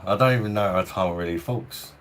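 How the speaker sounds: ordinary delivery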